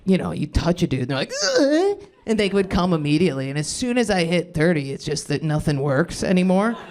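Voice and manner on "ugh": mock climax grunt. Retold climax groan.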